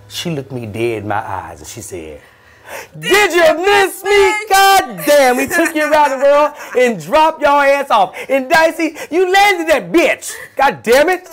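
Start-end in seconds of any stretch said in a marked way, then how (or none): 3.0-11.3 very high, loud, slow mocking shout; taunting driver voice. Gloria to her old passenger, very high loud gloating shout. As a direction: gloat like a villain returning. Voicing Gloria greeting him.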